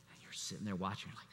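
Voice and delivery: usual speaking voice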